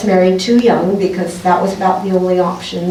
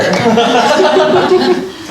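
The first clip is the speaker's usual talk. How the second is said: a higher, rising little laugh; rising giggle; 8 semitones higher than usual. Real, not acted. Poet laughs again at the noise before reading, giggling. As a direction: giggle as the joke sinks in.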